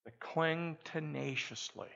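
ordinary delivery

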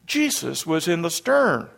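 ordinary talk

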